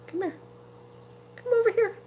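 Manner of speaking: very high singsong call to a pet; high cooing call to a pet fish; coaxing pet voice. Real, not acted. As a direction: coax the fish sweetly upward. Coaxing fish to come forward.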